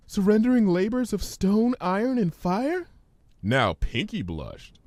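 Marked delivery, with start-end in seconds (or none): 0.1-2.9 high, hesitant frightened pony; Fluttershy's voice. Acted. Fluttershy fearfully reciting the ominous words, timid and high. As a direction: stammer it out nervously, higher.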